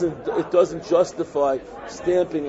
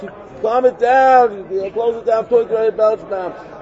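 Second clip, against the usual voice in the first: higher and louder, slowed, insisting he turn it down; raised, urgent; 5 semitones higher, 5 dB louder than usual. Retold or imitated. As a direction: urge him to calm it down. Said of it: "And I was like".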